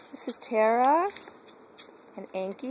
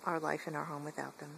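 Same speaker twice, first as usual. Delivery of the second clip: ordinary talk, nothing marked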